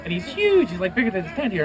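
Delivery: plain reading voice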